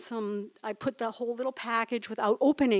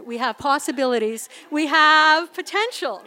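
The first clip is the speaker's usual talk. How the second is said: louder, rising joy; excited hope; 4 semitones higher, 9 dB louder than usual. Retold or imitated. Imagining the future after finding the pearl, rising excitement. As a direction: build up from hopeful to thrilled. Excited hopeful inner thought.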